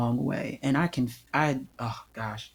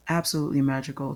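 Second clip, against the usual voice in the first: fast, light repeat of "Absolutely magical"; quick repeat; much faster than usual. Real, not acted. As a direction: toss it off quickly.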